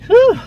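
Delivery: high, loud puff of relief; relieved whew. Real, not acted. Vlogger back in the car after a windy walk, loud relieved whew. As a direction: blow it out after the long walk.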